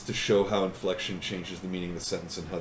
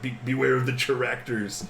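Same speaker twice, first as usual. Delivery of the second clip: mock warning; 4 semitones higher, 3 dB louder, slower than usual. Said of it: "running around being like".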